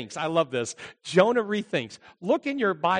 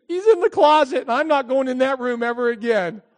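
put-on high, louder girl's voice; pitched-up, hammed-up refusal; frightened daughter; 6 semitones higher, 4 dB louder than usual. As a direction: declare it to mother in mock panic.

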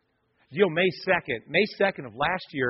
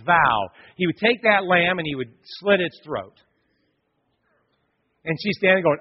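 louder, higher storytelling from the pulpit; preacher at full force, voice up high; fired-up preaching; 4 semitones higher, 6 dB louder than usual. Acted. Preacher builds the lamb story.

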